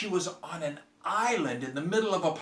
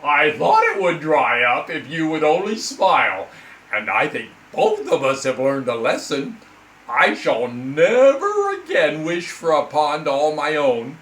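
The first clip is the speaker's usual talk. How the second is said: lamenting frog; 9 dB louder than usual. Acted. Frog complaining to the girl, loud and dramatic. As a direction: wail it like a theatrical frog. Frog's moral.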